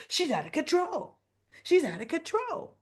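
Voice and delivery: high-pitched mimicked outrage; mock accusation. Mimics people accusing Danny.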